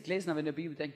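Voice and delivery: ordinary talk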